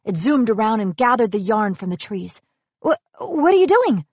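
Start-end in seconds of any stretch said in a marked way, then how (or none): 2.8-4.1 high-pitched, raised-voice question; startled call. A narrator's reading. Asha calling after the darting star, high startled call. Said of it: "Asha called".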